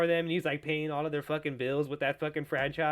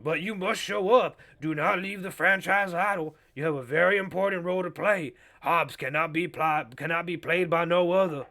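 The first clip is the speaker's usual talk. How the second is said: grand mock Southern oratory; Creole Southern man. Host replaying Vin Diesel's plea, Creole Southern accent. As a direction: plead pompously in a put-on Creole Southern accent. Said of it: "turning into, like, a, uh, Creole Southern man".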